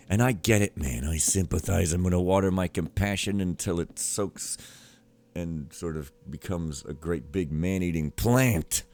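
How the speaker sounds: usual speaking voice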